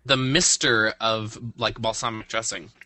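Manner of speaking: talking through hiccups; words broken by hiccups. Real, not acted. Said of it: "I have the hiccups".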